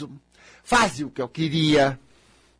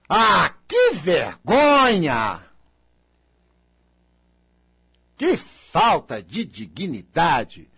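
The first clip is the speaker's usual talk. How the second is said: hammed-up scandal; 2 semitones lower, much slower than usual. Repeating family gossip, hammed-up slow scandalized outrage. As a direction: drag out the shocked gasp.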